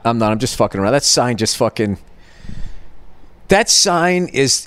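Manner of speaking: ordinary talk, nothing marked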